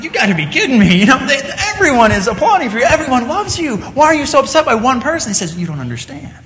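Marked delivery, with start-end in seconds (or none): 0.0-5.3 high, exasperated disbelief; incredulous protest. Acted. Onlooker argues with the upset pianist, incredulous. 5.6-6.3 soft reply, slowing into long pauses; hushed revelation. Acted. Upset pianist explains about the front row, hushed and weighty. Girl reveals who stayed seated.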